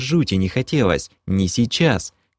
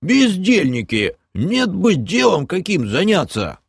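grumbling half-orc; 10 semitones higher, slower than usual. Acted. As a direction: scold the idlers gruffly. Bom continues grumbling.

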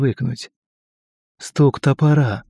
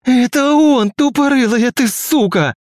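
high, loud furious scream; feral wasteland raider; 14 semitones higher, 4 dB louder, slower than usual. A narrator's reading. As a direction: spit it out in helpless rage. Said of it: "трясясь от беспомощного гнева".

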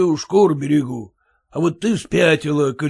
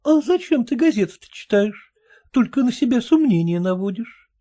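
high-pitched wife, nagging; wife chiding her husband; 7 semitones higher than usual. Acted. Wife to husband about newspapers, high nagging voice.

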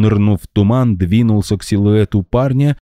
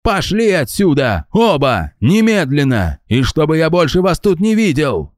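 high, slowed furious command; angry order; 11 semitones higher than usual. A narrator's reading. Angry man driving Max and Lucinda away, high furious. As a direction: throw them out, no argument.

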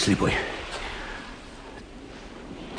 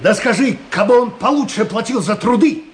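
scornful boast; 12 semitones higher than usual. Acted. Smuggler scoffs about his master.

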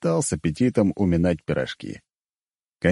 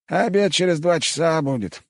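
higher put-on old man voice; old cook voice; 8 semitones higher than usual. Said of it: "пояснил Кантемирыч".

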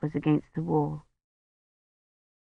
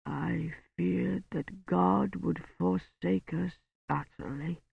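slow, much softer, thick-tongued words; blurred dying voice; 13 dB quieter, slower than usual. A narrator's reading. Dying Roche speaks to Kivrin, slow, soft and blurred. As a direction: speak through a swollen tongue.

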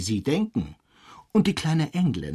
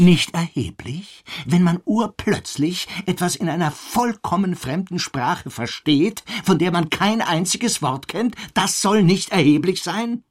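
indignant; 7 semitones higher, 3 dB louder than usual. Acted. Indignant reply to dismissal.

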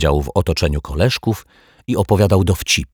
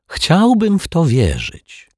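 high, drawn-out scepticism; ironic disbelief; 12 semitones higher, 3 dB louder, slower than usual. A narrator's reading. Detective doubts the police story, with irony. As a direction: lift the pitch in ironic doubt. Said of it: "pokiwał z ironią głową".

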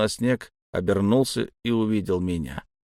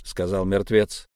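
plain reading voice

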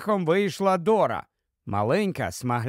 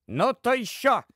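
high, defiant wifely retort; cutting retort; 7 semitones higher than usual. Acted. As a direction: cut the husband off sharply. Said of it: "відрізала Дора". (різка відповідь дружини)

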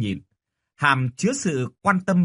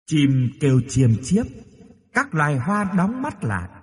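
ghostly mother's lullaby, slowing at the end; gentle, eerie drifting nursery rhyme; thin, floating lullaby voice; 3 semitones lower than usual. A narrator's reading. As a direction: croon it airily, drawing out the closing words. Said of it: "giọng nói du mỏng manh, nhẹ nhàng và đầy lững lờ".

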